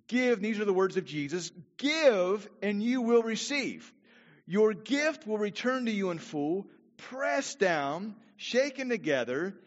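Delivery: preacher declaring the promise; preaching scripture. Real, not acted. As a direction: read the words of Jesus with force. Reading Jesus' words aloud.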